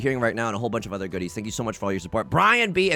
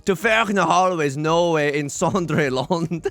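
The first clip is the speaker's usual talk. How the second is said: hammed-up old Middle English accent, slowed and rolling; theatrical Middle English accent, drawn out; Middle English reading; 2 semitones higher, slower than usual. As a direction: recite Chaucer with a mock medieval accent. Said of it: "Is this problematic?".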